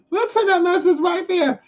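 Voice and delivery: squeaky, very high put-on repeat; mocking high voice. Laughing at Larry's retracted message, mocking high voice.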